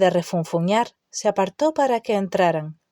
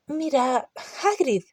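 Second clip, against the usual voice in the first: hesitant; 6 semitones higher, 4 dB quieter than usual. A narrator's reading. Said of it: "dijo Hermione con vacilación".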